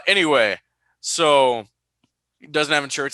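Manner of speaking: usual speaking voice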